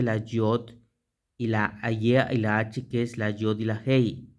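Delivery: ordinary talk, nothing marked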